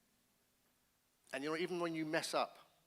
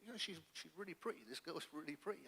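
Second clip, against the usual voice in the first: softer, private aside to himself; quietly imitated smitten thought; admiring inner thought; 4 semitones higher, 6 dB quieter than usual. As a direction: think it aloud under your voice. Voices his private thought, admiring her.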